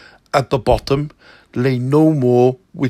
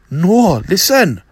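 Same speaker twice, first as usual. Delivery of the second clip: urgent, louder insistence; insistent; 6 semitones higher, 5 dB louder, slower than usual. Acted. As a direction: push through pain, insisting. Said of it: "Zero insisted".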